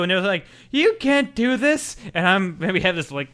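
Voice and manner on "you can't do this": high, slow, put-on shocked voice; protesting companion. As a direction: draw out the companion's objection.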